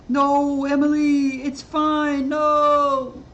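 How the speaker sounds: high, loud hammed-up sobbing plea; mock crying whine. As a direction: overdo a tearful protest. Mocking the crying streamer.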